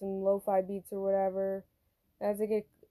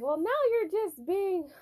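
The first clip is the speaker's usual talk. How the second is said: much higher, drawn-out mock scolding; scolding coworker imitation; 12 semitones higher than usual. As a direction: mimic a griping coworker, pitched way up. Acted quote of scolding coworker.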